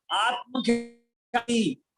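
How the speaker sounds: drawn-out slow answer. Shown as a demonstration. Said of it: "अरे जोर से बोलो [name]".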